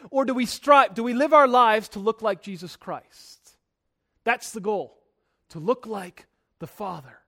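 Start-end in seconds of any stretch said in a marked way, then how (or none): none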